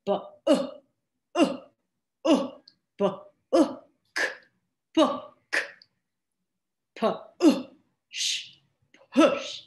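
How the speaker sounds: bu-, book, pu-, push sounded out slowly; short oo sound. Shown as a demonstration. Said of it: "The short sound would be, for example".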